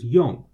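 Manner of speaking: "yong" loud and falling in fourth tone. Shown as a demonstration. Teacher explaining the tone exception rule, falling fourth tone on "yong". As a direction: punch "yong" and drop the pitch. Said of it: "it comes right before a fourth tone".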